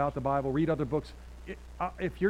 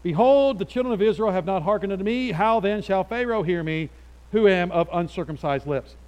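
raised, loud protest to the Lord; arguing; 5 semitones higher, 8 dB louder than usual. Acted. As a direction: plead the case loudly, higher-pitched. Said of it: "arguing with the Lord".

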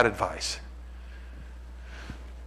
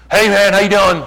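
loud, higher-pitched dude hello; mock guy greeting; 7 semitones higher, 9 dB louder than usual. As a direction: call it out like one dude to another. Voices guys greeting on plane.

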